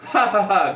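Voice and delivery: acted villain laugh; mock villainous cackle at moderate volume; Batman Who Laughs. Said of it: "the Batman Who Laughs ... going".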